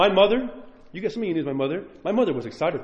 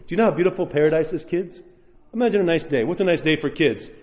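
ordinary talk

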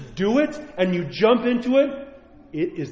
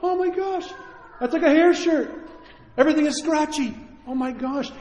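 much higher, astonished exclamation; retold amazement; 10 semitones higher than usual. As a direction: gasp at the scratchy shirt. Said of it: "I went".

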